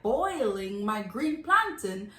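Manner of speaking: slow, drawn-out disbelief; incredulous. Acted. Customer questioning boiled green plantain, incredulous. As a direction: stretch each word in mock outrage.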